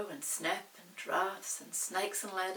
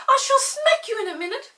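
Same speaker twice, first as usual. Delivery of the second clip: put-on high, loud childish threat; threatening boy; 18 dB louder than usual. Peter threatens Molly, high and cross.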